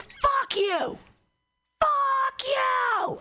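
hammed-up high furious scream. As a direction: scream it at your own reflection. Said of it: "he screams".

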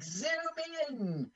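drawn-out camera command; Peter Cook. Acted. Imitating a man ordering the camera closer, drawn-out mock command. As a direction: stretch out the vowel as a mock command. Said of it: "Peter Cook will be like".

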